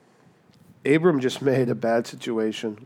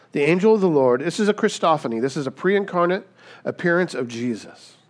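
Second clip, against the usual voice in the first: normal talking voice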